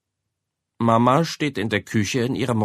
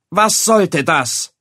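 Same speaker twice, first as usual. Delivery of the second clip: slow, louder and higher, each word weighted; stern accusation; 7 semitones higher, 6 dB louder, much slower than usual. A narrator's reading. Mother slowly demanding an explanation, stern. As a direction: demand an answer, stressing sollte.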